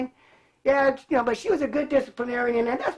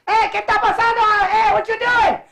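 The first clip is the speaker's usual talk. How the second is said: higher and louder, a mother's suspicious call; mom calling out; 7 semitones higher, 5 dB louder than usual. Retold or imitated. Mother calling from the house at the backyard fire, loud. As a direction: shout from the house like a wary mom.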